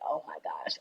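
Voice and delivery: imitating an awed, whispered gasp; hushed freak-out. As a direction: whisper it like a quietly retold freak-out. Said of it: "like, freaking out".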